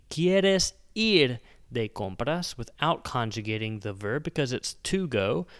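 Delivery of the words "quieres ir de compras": "¿Quieres ir de compras?" slow and clear, rising at the end; slow Spanish demo. Shown as a demonstration. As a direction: model "¿Quieres ir de compras?" slowly for learners. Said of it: "it would be".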